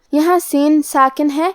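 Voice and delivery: ordinary talk, nothing marked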